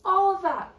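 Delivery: real loud dismay; anguished loud cry. Real, not acted. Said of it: "losing typed work".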